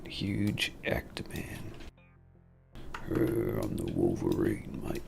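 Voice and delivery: low, slow growling Aussie impression; slow, gravel-throated mock Hugh Jackman; Wolverine growl. Gamer playing Wolverine to himself, slow hammed-up growl. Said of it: "can you dial back the Wolverines".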